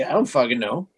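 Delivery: usual speaking voice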